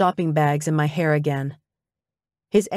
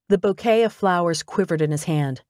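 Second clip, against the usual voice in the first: usual speaking voice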